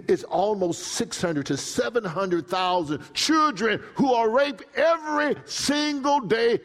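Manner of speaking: high, building, loud preaching; preaching outrage. Real, not acted. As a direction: hammer the numbers home.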